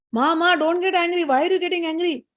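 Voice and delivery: high-pitched, imploring mock plea; pleading. Devtas begging Savitri not to be angry, pleading. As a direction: plead like a child to an upset mother.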